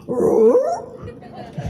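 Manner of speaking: a sudden high squeal of a laugh; high burst of laughter. Real, not acted. Laughing at the Scooby-Doo coincidence, a high squealing laugh.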